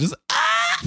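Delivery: very high, shrieking mock scream. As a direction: shriek like a terrified patient. Imagined patient screaming at swab.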